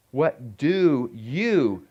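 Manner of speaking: very slow, with "you" drawn out and stressed; "What... do... you", landing on "you". Shown as a demonstration. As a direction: lean hard on "you", stretching each word. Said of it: "not somebody else".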